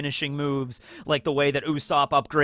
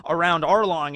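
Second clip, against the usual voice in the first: usual speaking voice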